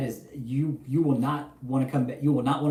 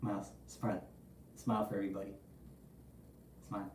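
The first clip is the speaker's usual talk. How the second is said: softer, gentle coaxing; coaxing the dog; 6 semitones lower, 16 dB quieter, faster than usual. Real, not acted. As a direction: quietly coax the pet to smile.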